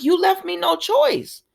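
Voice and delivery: high-pitched imitation of her justification; defensive retort. Elena answering Nate's plea, defensive high retort. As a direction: push the blame back in a high voice.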